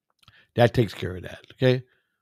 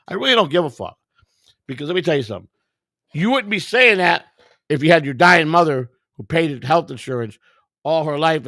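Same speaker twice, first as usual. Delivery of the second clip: angry rant; 7 semitones higher, 8 dB louder than usual. Real, not acted. Angry rant about dying mother.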